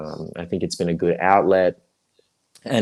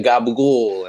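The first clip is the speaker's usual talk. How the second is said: louder Italian-American "gabagool"; Italian-American accent; 3 semitones higher, 5 dB louder than usual. Retold or imitated. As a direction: do an Italian-American New Yorker saying gabagool. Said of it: "the Italo-American accent".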